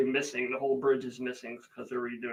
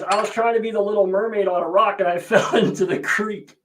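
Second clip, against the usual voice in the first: put-on higher, louder girl voice explaining; daughter's voice; 6 semitones higher, 8 dB louder than usual. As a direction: play the daughter sheepishly confessing. Daughter voiced in retold story.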